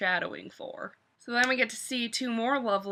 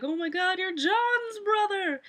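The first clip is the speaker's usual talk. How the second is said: excited Sam; 8 semitones higher than usual. Retold or imitated. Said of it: "and he's like".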